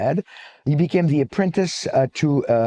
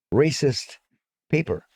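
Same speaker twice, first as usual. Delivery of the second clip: normal talking voice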